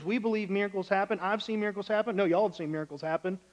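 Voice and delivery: ordinary delivery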